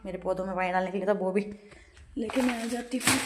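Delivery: normal talking voice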